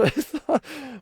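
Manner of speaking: sharp disbelieving laugh; high startled laugh. Real, not acted. Reacting to Blitzen's harsh insult with a laugh. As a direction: burst out laughing in shock.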